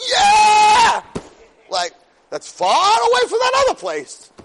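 very high, loud reenacted cheer; elated shout. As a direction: holler it high, overjoyed at the contrast. Retold elated reaction to girl's request.

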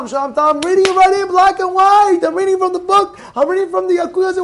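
high, insistent protest in a retold argument; heated retort. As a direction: argue back over the shouting. Acted shouting match over halakha.

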